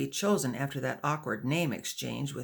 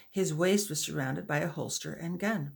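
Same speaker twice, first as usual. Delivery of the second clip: ordinary delivery, nothing marked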